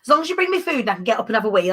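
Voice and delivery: ordinary talk, nothing marked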